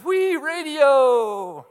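high, slow, drawn-out mouse voice; high squeaky drawn-out wee radio; squeaky mouse punchline. Acted. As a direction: stretch the punchline like a sliding mouse.

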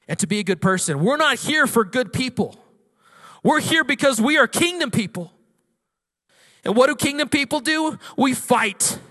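high, loud rallying sermon voice; fired-up preacher rousing the congregation; full-force preaching. Real, not acted. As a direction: preach it hard, driving each declaration.

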